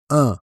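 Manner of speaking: un with a nasal vowel. Shown as a demonstration. Teacher showing learners the nasal vowel in un. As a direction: say un through the nose. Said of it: "This one is a nasal sound".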